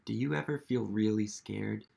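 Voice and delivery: usual speaking voice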